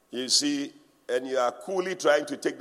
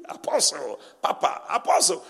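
mock flattering titles; 3 semitones lower than usual. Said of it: "they are calling you".

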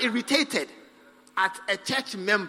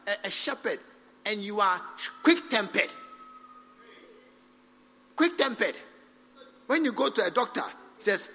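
normal talking voice

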